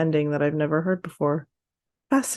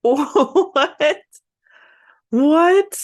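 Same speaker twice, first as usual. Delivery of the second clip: shrieking in disbelief, high and loud; a real, delighted shock; stunned what; 9 semitones higher, 7 dB louder than usual. Real, not acted. Laughing disbelief at the app.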